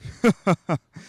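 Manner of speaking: short jolly giant's laugh; hearty chuckle. Acted. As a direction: chuckle like a jovial spirit. Ghost laughs again.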